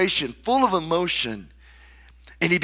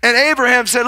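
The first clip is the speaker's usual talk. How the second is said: ordinary delivery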